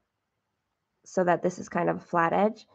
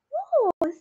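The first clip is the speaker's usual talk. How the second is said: high, airy "woo" of triumph; celebratory whoop. Real, not acted. Stitcher celebrating getting the viewer's name right, celebratory whoop. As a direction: let out a high-pitched cheer. Cheers at being correct.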